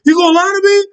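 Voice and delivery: mock furious boyfriend pressing her; imitating the angry boyfriend. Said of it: "When he start doing that".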